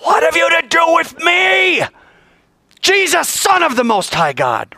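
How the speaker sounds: high, loud scream; full-voiced, high-pitched shriek at an enemy; screaming demoniac. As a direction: scream it out like a man possessed. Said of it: "And he screamed in a loud voice".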